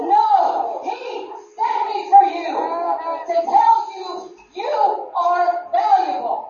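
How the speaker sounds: loud, slow, deliberate; emphatic proclamation. Real, not acted. Preacher tells the congregation they are valuable, slow and loud. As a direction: land each word slowly.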